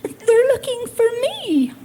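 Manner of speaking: put-on a hiding child explaining sweetly; little child's voice. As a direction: deliver the punchline as a tiny child. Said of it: "still whispering, the little voice said".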